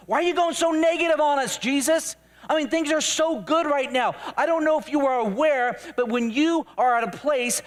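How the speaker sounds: high-pitched, worked-up protest; exasperated disciple arguing, voice pushed up; indignant rebuke. Acted. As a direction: scold and plead in a comic rant. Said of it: "began to rebuke him".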